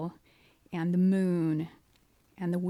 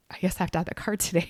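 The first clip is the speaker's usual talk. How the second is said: resigned; much faster than usual. Retold or imitated. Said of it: "we're like".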